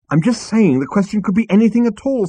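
high, loud protest; exasperated. Acted. Arthur defending himself to Zaphod, exasperated.

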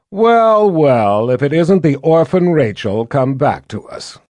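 loud, high gloating taunt; gloating princess. A narrator's reading. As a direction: sneer it out, pleased with herself. Violet gloating at Rachel.

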